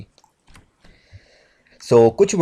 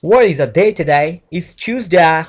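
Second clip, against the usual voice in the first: loud, slow British-accent demo; English accent; 3 semitones higher, 6 dB louder, much slower than usual. Shown as a demonstration. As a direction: exaggerate the British vowels, slowly. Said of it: "इसको कहते हैं ट्यूसडे".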